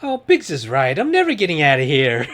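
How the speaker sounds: put-on whiny farm boy complaining; whiny Luke. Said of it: "he's so fucking whiny".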